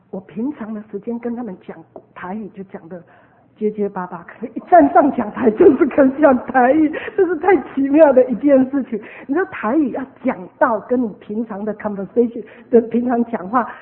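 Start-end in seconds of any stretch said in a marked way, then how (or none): 4.2-9.0 loud, emphatic amazement; excited marvelling. Real, not acted. Preacher telling how Taiwanese came on stage, excited marvelling. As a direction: testify with raised, louder voice. Marvelling aloud at sudden ability.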